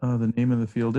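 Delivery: ordinary talk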